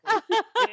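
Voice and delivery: bright burst of laughter; a quick delighted giggle; high giggle. Real, not acted. Reacting to his doubt.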